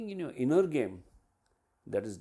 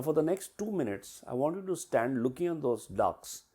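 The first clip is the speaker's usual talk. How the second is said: usual speaking voice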